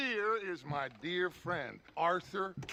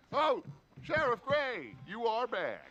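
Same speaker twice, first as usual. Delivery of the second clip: fawning greeting; 7 semitones higher than usual. Acted. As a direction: gush over the sheriff.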